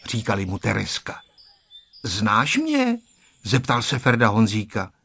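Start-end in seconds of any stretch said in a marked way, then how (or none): 2.0-3.0 put-on high-pitched child asking, rising at the end; high, questioning kid voice; child's question. A narrator's reading. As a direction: ask in a much higher child's voice. Child asks a child in story.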